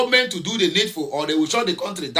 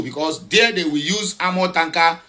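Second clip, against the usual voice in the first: ordinary talk, nothing marked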